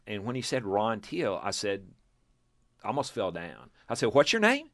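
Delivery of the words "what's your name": "What's your name?" jumping much higher and louder in shock; stunned disbelief. Retold or imitated. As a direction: blurt it out like you almost fell down. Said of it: "And I said it like that".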